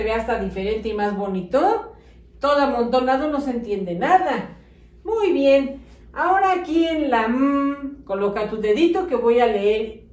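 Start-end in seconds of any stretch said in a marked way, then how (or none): none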